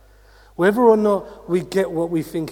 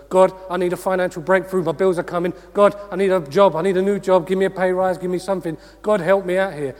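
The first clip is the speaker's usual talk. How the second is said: rapid, louder run of pleas; demanding child; 3 semitones higher, 5 dB louder, faster than usual. Acted. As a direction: pester God with a wish list, urgent and pushy. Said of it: "go like our children and go".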